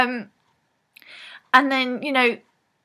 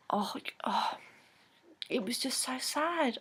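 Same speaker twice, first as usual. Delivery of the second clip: breathy, much softer whisper of sorrow; sad whisper; 3 semitones higher, 12 dB quieter than usual. Real, not acted. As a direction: let the sadness drop to a whisper. Recalling the sad ending.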